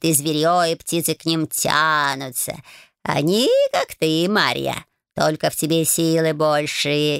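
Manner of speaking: slow purring cat voice. Acted. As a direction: drawl it like a smug old cat. Cat Bayun long speech.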